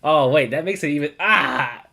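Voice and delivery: ordinary talk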